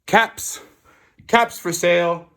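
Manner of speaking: high, loud, chanting peddler's cry; street vendor's call. A narrator's reading. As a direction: call out your wares across town. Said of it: "walked back into the town calling".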